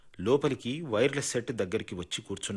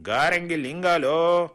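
slow, loud, drawn-out reading of a name; 7 semitones higher, 7 dB louder, slower than usual. A narrator's reading. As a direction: read the name out slowly and loudly, like a roll call. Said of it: "నెమ్మదిగా చదివాడు పిళ్ళై". (నెమ్మదిగా, గట్టిగా పేరు చదవడం)

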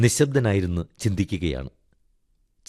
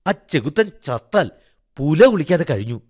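higher, emphatic bitter curse; harsh and emphatic, voice raised in pitch; pressed, harsh tone; 7 semitones higher, 5 dB louder than usual. A narrator's reading. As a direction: bite down on each word like an angry wife. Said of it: "Madhaviamma അമർത്തി പറഞ്ഞു".

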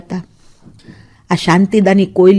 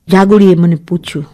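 ordinary delivery

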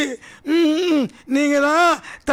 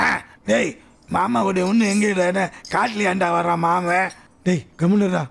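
put-on much lower voice for the children whispering about their uncle; low children's chatter; 8 semitones lower than usual. Children puzzling over their uncle, in a low voice. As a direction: chatter between the kids, pitched well below the narrator.

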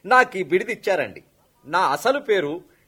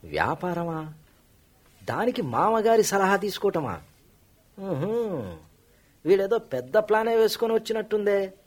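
much softer, muttering to himself; muttered aside; 8 dB quieter than usual. Acted. Suspicious son-in-law muttering to himself about his rival, very soft. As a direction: say it under your breath so the host can't hear. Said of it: "మీలో మీరే ఏదో అనుకుంటున్నారు".

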